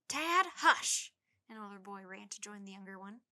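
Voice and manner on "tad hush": scolding call. A narrator's reading. Older brother scolding Tad.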